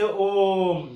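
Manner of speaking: ओम with the ओ held very long and loud; prolated vowel. Shown as a demonstration. Teacher giving an example of a triple-length vowel, ओम drawn out. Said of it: "तीन गुना समय लगता है".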